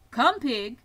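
loud livestock call; calling a pig. A narrator's reading. Farmer calling the pig to food.

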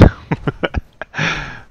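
high, rolling chuckle; laugh. Real, not acted. Player amused by his own jibe, high laugh. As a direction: keep laughing at your own joke.

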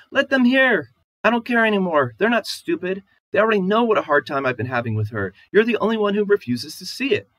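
high, loud, aggrieved outburst; wife protests to her husband about his mother, high and loud; upset wife. Acted. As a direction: pour out your grievance against him.

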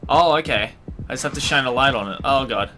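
normal talking voice

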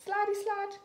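put-on high squeaky toddler pig voice; high giggly little pig; giggly piglet George. A narrator's reading. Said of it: "giggles George".

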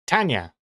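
Tanya said TAN-ya; two-syllable Tanya. Shown as a demonstration. Streamer who always thought two syllables, Tanya as tan-ya. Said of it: "Two syllables".

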